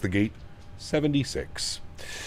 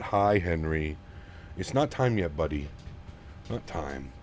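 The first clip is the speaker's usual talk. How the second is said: low, soft reassurance, trailing quieter; gentle hushing; 7 semitones lower, 7 dB quieter than usual. Real, not acted. Streamer telling buddy Henry it's not time yet, gentle and low. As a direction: gently put off an eager buddy, voice dropped low. Talking softly to the dog Henry.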